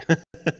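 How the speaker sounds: short bright laugh; nervous laugh. Real, not acted. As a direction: laugh off the nerves. Nervous laugh about trying question.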